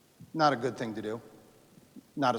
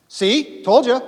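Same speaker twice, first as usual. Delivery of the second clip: loud, higher told-you-so; gloating; 9 semitones higher, 12 dB louder, slower than usual. Retold or imitated. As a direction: rub it in their faces. Revere gloats at hearing shots.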